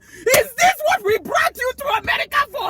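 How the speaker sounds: loud, high scolding dad; a dad outraged at his daughter; shouting dad. Retold or imitated. Dad scolding his heartbroken daughter, mock shout.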